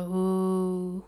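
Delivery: long, drawn-out eerie ooh; imitated moan. Podcaster mimics a noise caught on the recording, moaning. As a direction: moan a slow, soft ghostly ooh. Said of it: "sounded a little like a moan".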